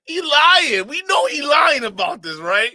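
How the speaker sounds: high, loud callout to the audience; shouting that he is lying; excited accusing shout. Real, not acted. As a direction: yell it to chat. Accusing friend to chat.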